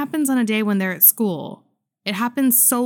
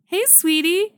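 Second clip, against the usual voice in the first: friendly greeting; 10 semitones higher, slower than usual. Retold or imitated. Maintenance man greets the girl.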